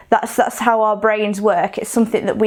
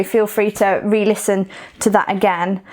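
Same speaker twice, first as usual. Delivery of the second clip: ordinary delivery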